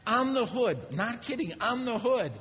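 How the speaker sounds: high, loud, excited rush; frantic retelling. Retold or imitated. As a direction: tell it like you're still chasing her.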